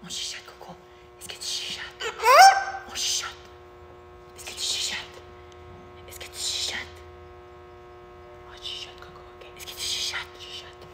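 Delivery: soft, voiceless whisper. Real, not acted. Handler hushing an excited parrot, whispering. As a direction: whisper coaxingly, as if calming an excited pet. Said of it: "il faut chuchoter".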